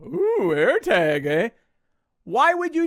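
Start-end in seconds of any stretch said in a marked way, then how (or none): 0.1-1.5 mocking "ooh". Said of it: "why would you go".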